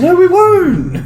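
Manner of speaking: squeaky denial. Real, not acted. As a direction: squeak it out in mock innocence. Joking denial, much higher.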